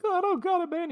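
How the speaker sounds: very high, loud mock panic; panicked pawnbroker. Jeeves pleading with the gunman, panicked squeaky voice. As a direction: squeal it like a cornered cartoon coward.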